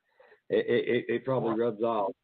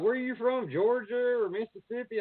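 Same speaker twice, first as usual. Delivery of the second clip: imitating outsiders; 10 semitones higher than usual. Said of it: "They're like".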